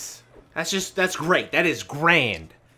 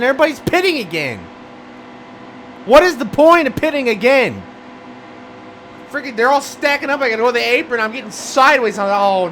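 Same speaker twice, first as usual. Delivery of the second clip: high, loud frustrated rant; a racer losing it at yet another caution; exasperated shouting; 9 semitones higher, 6 dB louder than usual. Real, not acted. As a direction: yell at the screen in disbelief.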